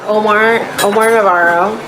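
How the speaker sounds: ordinary talk, nothing marked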